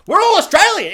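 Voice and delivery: very high, loud re-enacted excitement; mock elated outburst. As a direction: burst out with hammed-up national pride. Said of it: "cheeky look to the person I was watching it with".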